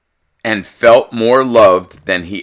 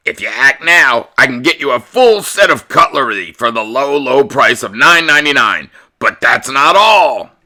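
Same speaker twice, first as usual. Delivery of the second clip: infomercial pitchman; 4 semitones higher than usual. Acted. The TV pitching cutlery to Todd's dad like an infomercial. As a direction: sell it like a late-night TV ad.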